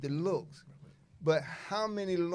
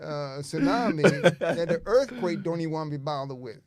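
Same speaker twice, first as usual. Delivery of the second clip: laughing; 6 dB louder than usual. Real, not acted. Laughing hyperbole.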